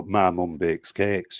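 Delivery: slow, drawn-out regional British accent; demonstrating a regional accent; put-on regional accent. Shown as a demonstration. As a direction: stretch each word in another regional British accent. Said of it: "I can do other accents".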